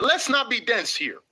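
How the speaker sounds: normal talking voice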